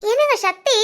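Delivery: sugary respectful wife address; mock-respectful wife to husband, sweet and formal; overly respectful address. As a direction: lay the respect on thick, sweetly. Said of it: "திடீர்னு நீங்க நாங்கங்கிற".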